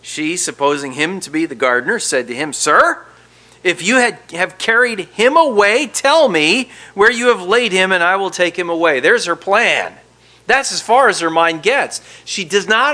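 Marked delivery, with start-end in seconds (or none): 2.5-9.0 pleading cry. Retold or imitated. Preacher voices pleading Mary.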